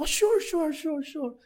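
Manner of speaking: very high, quick eager yes from a student; a timid student saying sure to the professor. Retold or imitated. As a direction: squeak out sure like a nervous student agreeing. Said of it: "他说：".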